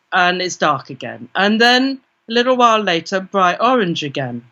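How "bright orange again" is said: ordinary delivery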